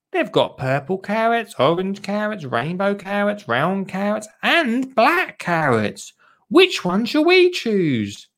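high-pitched sing-song list, slowing at the end; excited child character. Acted. Lottie shows Dottie the carrot packets, high child-character voice.

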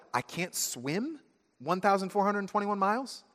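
high, rising on "thousand" in disbelief; "one thousand four hundred twenty-one miles?" as a horrified question; you realizing you cannot swim that far; dismayed realization. Acted. Realizing the distance is hopeless.

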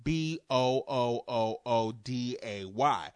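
slow, teasing spell-out of the long boo sound; drawn-out spelling. Shown as a demonstration. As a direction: spell "B O O O O D A Y" slowly, stretching the O's. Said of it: "it would have been spelled".

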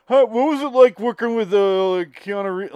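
high, loud mock fan question; eager fan. Fan on a plane quizzing the actor, high and eager. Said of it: "you're sitting there going".

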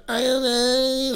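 put-on a toddler who can't decide, said high and slow. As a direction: drag out I don't know like a small child. Toddler answering.

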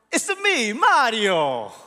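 playful cartoon Italian catchphrase; Mario's Italian accent. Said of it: "in that, you know, wonderful Italian accent".

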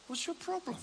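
high, soft, puzzled challenge; mock incredulous. Acts out questioning the remorseful believer.

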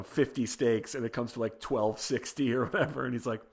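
ordinary talk

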